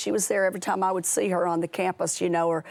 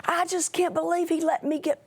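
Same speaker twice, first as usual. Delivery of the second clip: put-on much higher whiny teen girl; upset teen girl; 10 semitones higher than usual. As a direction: voice the indignant girl, pitched up high. Girl quoted, sobbing.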